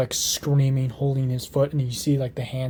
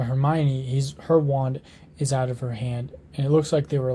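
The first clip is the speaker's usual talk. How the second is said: usual speaking voice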